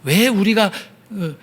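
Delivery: normal talking voice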